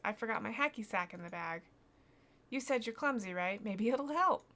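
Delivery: lightly voiced eager kid; helpful boy. Voices boy offering hacky sack.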